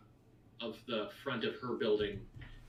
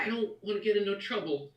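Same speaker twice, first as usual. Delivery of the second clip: put-on worried child; 8 semitones higher, faster than usual. Boy fears trouble.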